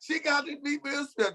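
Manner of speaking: high-pitched mock gasp of disbelief; mock shock. Cohost retelling her reaction, in high mock shock. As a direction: act out being stunned. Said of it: "I said".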